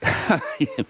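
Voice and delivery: knowing laugh; short cheeky chuckle; sly chuckle. Acted. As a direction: chuckle at the secret. Coy about Margaret Griffiths.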